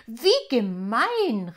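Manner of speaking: laut und langsam ausgerufen; empörter Ausruf. Acted. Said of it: "rief Rubinia". (Rubinia ruft empört über den Zettel, laut und gedehnt)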